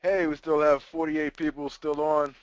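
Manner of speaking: normal talking voice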